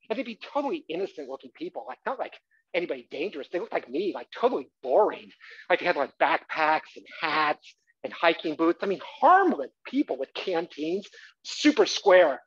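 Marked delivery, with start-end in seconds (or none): none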